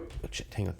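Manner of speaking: near-whisper, voiceless aside; muttering to self. Real, not acted. Game master stops to find the voice, muttering under his breath. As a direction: whisper it to yourself.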